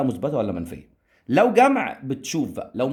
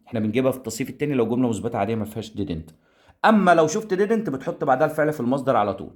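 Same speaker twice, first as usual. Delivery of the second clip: normal talking voice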